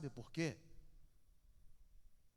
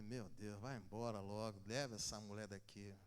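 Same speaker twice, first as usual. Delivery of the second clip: hushed prayer; 5 semitones lower, 5 dB quieter than usual. Retold or imitated. His inner prayer, hushed.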